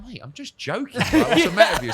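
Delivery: high-pitched, incredulous comeback; mock exasperated appeal to a mate; retold protest. Acting out his protest to rival.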